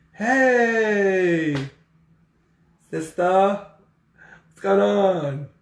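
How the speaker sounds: wife forcing a cheery voice to her sister on the phone; forced cheerful voice. Retold or imitated. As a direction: mask the tears with a brave cheery greeting. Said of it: "in the best voice she can muster".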